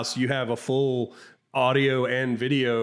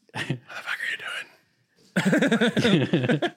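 whispered; 6 semitones higher, faster than usual. Retold or imitated. Toddler stares, he voices it.

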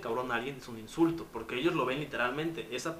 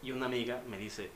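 ordinary talk

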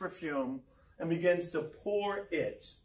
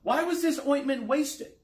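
higher, mildly indignant quoted question; 7 semitones higher, 3 dB louder than usual. A narrator's reading. Disciples protesting about poured-out ointment, indignant. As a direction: raise the pitch as if objecting. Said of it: "who said to themselves indignantly".

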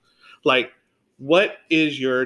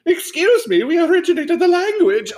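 very high, louder, mock-offended diva voice; 5 dB louder than usual. [name] snapping back at [name], high indignant squeal. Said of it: "And she goes".

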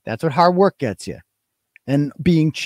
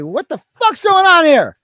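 raised, high, loud complaint; angry demand; 11 semitones higher, 5 dB louder than usual. Retold or imitated. Resident calling the town about his mailbox, retold angry shout. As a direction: imitate an irate caller demanding answers.

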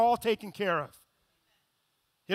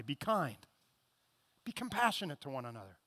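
soft, fast, lower plea to be kind; tender reading; 8 semitones lower, 11 dB quieter than usual. Acted. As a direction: read it gently, like a comfort.

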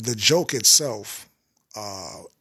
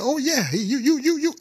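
much higher, laughing yeah, tripping over you; too excited; 13 semitones higher than usual. Acted. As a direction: let the excitement run away, voice jumping up. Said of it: "he had to change his voice 'cause he was getting too excited".

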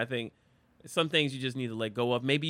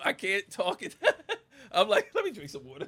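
laughing; 6 semitones higher, 4 dB louder than usual. Real, not acted. Laughing at his own choking.

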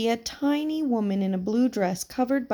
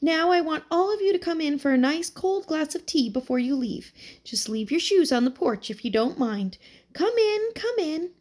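put-on old woman voice; 7 semitones higher than usual. A narrator's reading.

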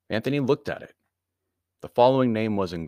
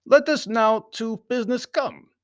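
high, louder Baron's voice; formal foreign Baron; 8 dB louder than usual. A narrator's reading. Baron turning to business, high formal voice. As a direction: declare it formally, pitched well up. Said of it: "said the Baron".

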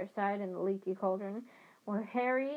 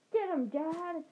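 cheering yell, higher; 8 semitones higher, 3 dB louder, slower than usual. Acted. Twins cheering their dad into the fight, yelling. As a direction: egg Dad on with a shout. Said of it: "with a yell of".

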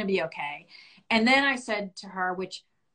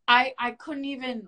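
ordinary talk

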